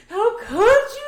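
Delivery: very high, loud mock wail; hammed-up anguished cry; mock crying breakdown. Reenacting her breakdown at Luis, high loud mock-crying. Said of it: "broke down and I kept going and crying".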